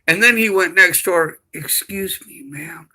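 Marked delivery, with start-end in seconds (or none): 1.5-2.9 hushed. Retold or imitated. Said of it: "And he started whispering".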